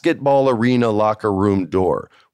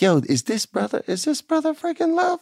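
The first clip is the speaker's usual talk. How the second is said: high-pitched incredulous retelling; amazed disbelief. Acted. Newcomer reacting to a wrestling figure, incredulous. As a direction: voice climbing in mock amazement. Star-struck reaction to meeting someone.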